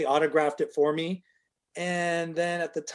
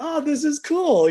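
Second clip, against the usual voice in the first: high, louder burst of enthusiasm; retold delight; 12 semitones higher, 5 dB louder than usual. Husband reacting to wife's find, re-enacted excitement. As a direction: re-enact being thrilled at a discovery.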